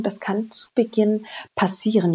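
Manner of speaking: ordinary talk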